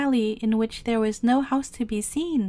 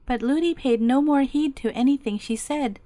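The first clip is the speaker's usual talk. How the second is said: ordinary delivery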